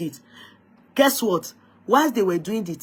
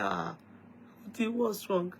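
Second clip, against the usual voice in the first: a husband's soft, far quieter worried question; worried husband, hushed; 11 dB quieter than usual. Retold or imitated. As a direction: quietly ask your sick wife what's wrong. Husband voiced again, worried.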